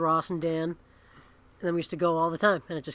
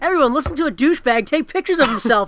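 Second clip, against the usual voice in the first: high, loud hammed-up announcer voice; teasing podcast-intro voice, pitched way up; mock announcer; 9 semitones higher, 8 dB louder than usual. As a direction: introduce him like a cheesy show host.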